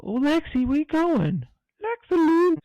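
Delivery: yelling across the room. Real, not acted. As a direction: call Lexi back from afar. Calling to Lexi wandering off.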